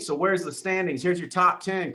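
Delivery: ordinary talk, nothing marked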